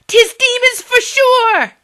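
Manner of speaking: slow, loud, high terrified shriek. Acted. Villager convinced of demons, slow loud shriek. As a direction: drag out the shriek in panic. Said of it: "shrieked another".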